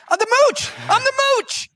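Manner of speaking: very high, loud mocking imitation; insistent guest. Guest pleads to be recognised, loudly insistent. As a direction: squawk the nickname as a desperate self-introduction.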